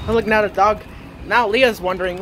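usual speaking voice

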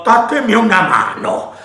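shouted. A narrator's reading. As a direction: bellow for help in a rage. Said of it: "gridò".

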